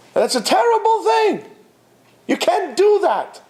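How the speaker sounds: a pained high-pitched rebuke to the crowd; exasperated rebuke. Real, not acted. Rebbe scolding the audience.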